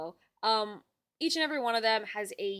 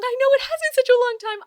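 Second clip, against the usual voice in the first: excited; 13 semitones higher, 11 dB louder than usual. Real, not acted. Reunion with an old friend.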